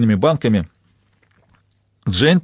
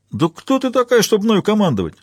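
very high-pitched affronted retort; squawky indignation; indignant tramp; 10 semitones higher than usual. A narrator's reading. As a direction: challenge her, outraged, voice pitched way up. Tramp retorts to being sent away.

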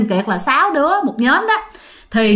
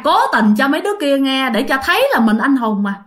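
usual speaking voice

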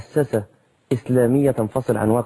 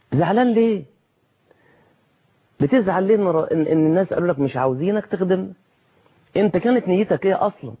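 heated rhetorical challenge; 6 semitones higher than usual. Acted. Preacher challenging sore election losers.

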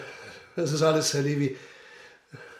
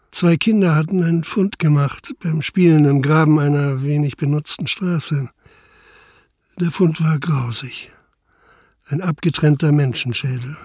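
story narration; 9 dB louder than usual. A narrator's reading. Inspector narrates the case.

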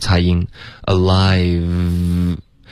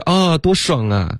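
higher mock delight, sarcastic; mock sarcasm; 9 semitones higher than usual. Teacher voicing Chandler's sarcastic meaning, higher mock delight. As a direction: fake joy you don't feel. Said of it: "就是在说反话".

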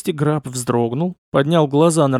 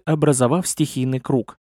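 usual speaking voice

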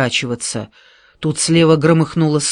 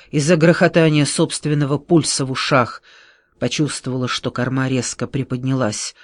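plain reading voice

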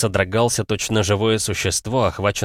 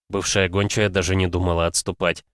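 usual speaking voice

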